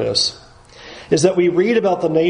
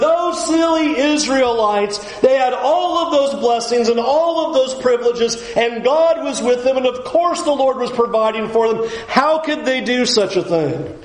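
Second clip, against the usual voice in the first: mocking scoff; 9 semitones higher than usual. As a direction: sneer at them from a safe distance. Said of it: "and we go".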